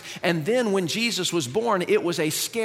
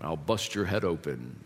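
hammed-up deep divine warning, no louder than normal talk; mock booming God; 10 semitones lower, 3 dB quieter than usual. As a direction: play a threatening God from the heavens, deep and deliberate. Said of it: "just start speaking real loudly".

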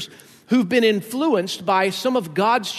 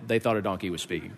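self-mocking mutter; 11 semitones lower, 8 dB quieter, faster than usual. Real, not acted. Joke about his own voice.

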